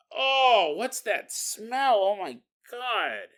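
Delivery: loud, drawn-out groan of revulsion; acted disgust. Walker catches the rotting smell, acted disgust.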